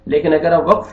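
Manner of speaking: normal talking voice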